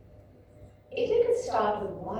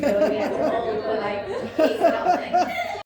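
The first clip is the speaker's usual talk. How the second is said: rising into a loud laugh, higher, louder; 8 semitones higher, 13 dB louder, slower than usual. Real, not acted. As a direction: joke about it and burst out laughing. Joking about breathing exercise length.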